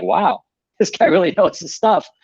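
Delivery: a laugh breaking into an impressed quote; mock-amazed, chuckling through it; amazed traveler voiced with a laugh. Retold impressed reaction of travelers.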